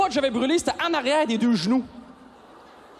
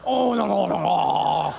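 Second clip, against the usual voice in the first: protestation imitée; 7 semitones lower, much slower than usual. Retold or imitated. Réaction imitée, voix différente.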